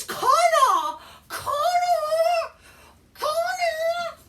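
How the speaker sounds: high, loud mock hype scream; screamed Kana. Mocking Asuka's Rumble return, screaming her old ring name. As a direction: shriek the name like a hyped-up crowd.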